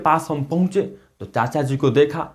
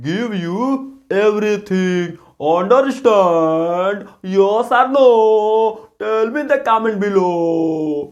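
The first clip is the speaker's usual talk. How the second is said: English through a Bhojpuri lilt, pitched up on the questions; Bhojpuri-accented English; 3 semitones higher, much slower than usual. Shown as a demonstration. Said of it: "Bhojpuri style में".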